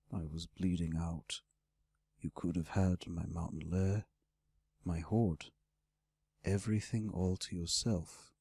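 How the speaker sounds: low, hushed dragon voice; great red dragon, troubled. Acted. Dragon reproaching the one who saved him, low and soft. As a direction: brood low as the wounded dragon. Dragon figure in narrated dialogue.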